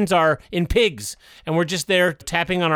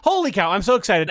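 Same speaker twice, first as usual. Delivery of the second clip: ordinary talk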